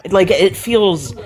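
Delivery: usual speaking voice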